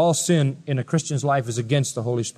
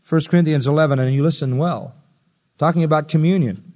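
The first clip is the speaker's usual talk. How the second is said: ordinary talk, nothing marked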